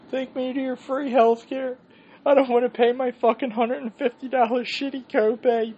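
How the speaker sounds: high, wheezy plea while laughing too hard; breathless from laughing. Retold or imitated. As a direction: squeak it out like you can't breathe for laughing. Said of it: "And I'm like".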